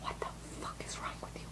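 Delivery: imitating a baffled mother, quick whisper; mom's appalled whisper. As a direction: whisper it fast, barely audible.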